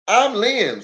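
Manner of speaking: high-pitched country introduction; high folksy self-introduction; Lem's voice. Acted. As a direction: introduce yourself as Lem. Lem introduces himself.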